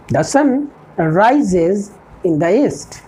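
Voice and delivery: each word spaced out for learners; a teacher dictating an English model sentence, slow and clear; slow dictation. Shown as a demonstration. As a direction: spell it out slowly like a teacher at the board.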